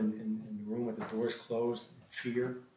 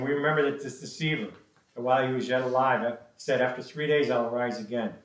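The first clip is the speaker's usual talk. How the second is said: scripture reading; 2 semitones higher, 6 dB louder than usual. Acted. Religious leaders speaking to Pilate, read out as emphatic scripture. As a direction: read out the accusation forcefully.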